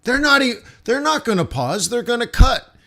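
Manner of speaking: high, loud, mock-confident sucker voice; hammed-up know-it-all, pitched high; mocking the idiots. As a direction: parody the clueless traders, high and loud. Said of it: "They're like".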